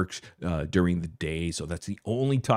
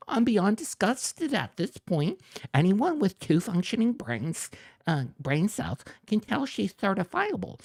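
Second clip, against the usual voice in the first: much higher, prissy mock voice; shrill mocking read; 11 semitones higher than usual. Mocking the rival's post for viewers in a much higher prissy voice. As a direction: parody him as a prissy whiner, pitched way up. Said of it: "reading the rival's post".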